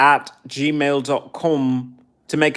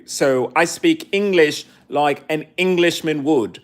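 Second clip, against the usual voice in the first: ordinary talk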